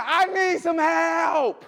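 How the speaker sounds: very slow drawn-out scream of "I need some help". Retold or imitated. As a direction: yell it across the office. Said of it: "I'm literally screaming".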